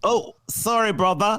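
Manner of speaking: put-on half-hearted British accent on the phone; playing a British prince, a light stab at British. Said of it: "British or whatever accent".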